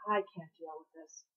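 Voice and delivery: soft, low, almost unheard; near-silent muttered complaint; barely audible. A narrator's reading. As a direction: say it so quietly it nearly goes unnoticed. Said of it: "It was quiet enough to almost go unnoticed".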